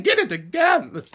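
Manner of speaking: high, loud mock scold; amused scold. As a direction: snap at her to pull it together.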